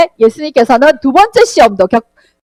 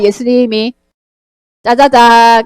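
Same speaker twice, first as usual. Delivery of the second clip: ordinary talk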